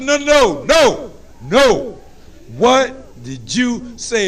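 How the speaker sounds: high, slow no no, then a dragged-out what; mama daring him. Retold or imitated. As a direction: slowly drag out the challenge. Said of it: "And your mama said".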